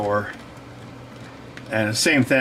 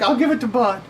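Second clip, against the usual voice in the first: mom's voice; 10 semitones higher than usual. Retold or imitated.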